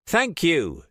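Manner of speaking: high, loud cry of vindication; triumphant. A narrator's reading. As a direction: crow over the win. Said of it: "triumphant his deduction had been recognized".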